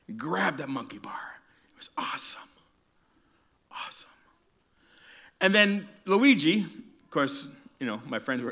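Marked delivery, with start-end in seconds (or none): 0.1-2.4 awestruck whisper; hushed awe. Retold or imitated. Preacher telling kids of his childhood leap, awed whisper. 3.7-4.1 awestruck whisper; hushed awe. Retold or imitated. Preacher telling kids of his childhood leap, awed whisper.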